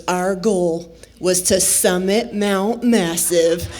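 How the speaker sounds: lightly imitated battle commander, low and slow; mock battle leader. Friend rallies hikers as if for battle, mock-solemn. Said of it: "like she's leading us into battle".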